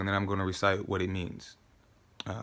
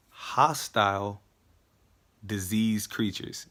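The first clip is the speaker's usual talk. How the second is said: very slow, weighty declaiming; drawn-out, emphatic paraphrase; deliberate recitation; 3 dB louder, slower than usual. Acted. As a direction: space out each adjective with emphasis.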